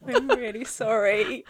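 mock-sorry to Dan, barely hiding delight; fake apology. Shown as a demonstration. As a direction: apologise but secretly pleased. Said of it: "because I say it like this".

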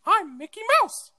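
very high squeaky cartoon mouse voice; Mickey Mouse voice. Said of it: "what was that an impression of".

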